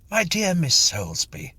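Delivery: lightly voiced gentleman, a touch brighter; a hint of an eager, chatty gentleman greeting a lady. A narrator's reading. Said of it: "he said".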